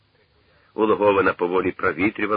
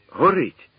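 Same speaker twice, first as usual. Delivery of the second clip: high, loud cry; sudden exclamation; 9 semitones higher, 5 dB louder than usual. A narrator's reading. Khoma suddenly spots the burning plane, exclaiming. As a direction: burst out with it, pitched high. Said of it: "раптом вигукнув Хома".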